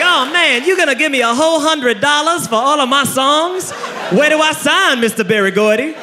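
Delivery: put-on high, gullible young singer voice; easily tricked young star; young Motown singer. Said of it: "like a young Motown singer".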